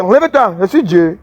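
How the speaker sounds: ordinary talk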